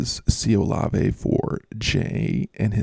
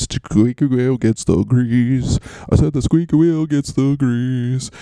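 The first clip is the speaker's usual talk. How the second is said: louder, slow, in a put-on voice; hammed-up cliche; 2 semitones higher, 4 dB louder, slower than usual. Shown as a demonstration. Host leans into the cliche for listeners, hammed-up and loud. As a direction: belt the cliche out like a mock announcer. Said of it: "but let's do it".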